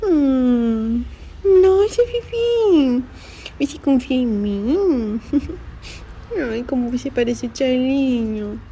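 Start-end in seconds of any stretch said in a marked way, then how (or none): 1.6-3.0 high, slow cooing. Real, not acted. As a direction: marvel at the kitten in a high coo. Baby talk to kitten. (voz fininha e lenta de carinho)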